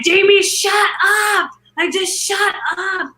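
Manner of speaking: high, stretched-out snapping shout; exasperated high-pitched outburst, drawn out; retold yell. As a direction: imitate losing patience with someone cheering you on. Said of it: "I yelled at everyone".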